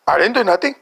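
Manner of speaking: imitated defensive denial; mock indignant protest. Mom protests in retold quarrel.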